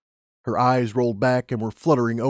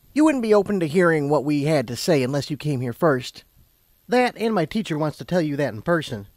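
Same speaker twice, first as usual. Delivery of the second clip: higher character voice; Twilight, explaining; 5 semitones higher than usual. A narrator's reading. Twilight explaining to Lumina why she had to come, patient.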